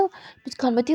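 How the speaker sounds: ordinary delivery, nothing marked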